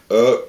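loud burp mid-sentence. Real, not acted. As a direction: burp out loud, then apologise.

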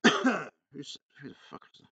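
normal talking voice

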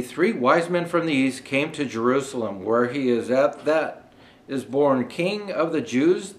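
usual speaking voice

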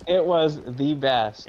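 THE BEST drawn out slow and loud; emphatic insistence. Real, not acted. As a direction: insist it was THE BEST, leaning slowly on each word. Insisting in a friendly argument.

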